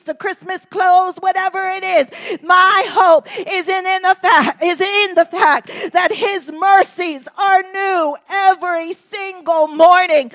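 ordinary delivery

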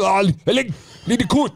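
high, squeaky mock Sumerian accent for "Ah, I need a coat". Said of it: "I don't know what a Sumerian accent is".

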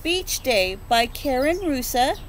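plain reading voice

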